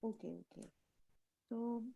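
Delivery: faint, far-off voice on a weak line; barely audible. Real, not acted. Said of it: "but your voice is very low".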